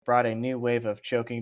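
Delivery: ordinary talk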